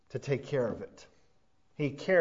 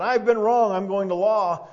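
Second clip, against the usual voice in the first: indignant complaint; 5 semitones higher, 8 dB louder than usual. Retold or imitated. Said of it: "This man said".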